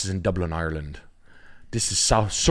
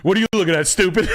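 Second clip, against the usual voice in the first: higher, louder taunting thug; mock tough guy; 11 semitones higher, 5 dB louder, faster than usual. Streamer pretending to taunt a stranger, hammed-up. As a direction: jeer at a passerby in jest.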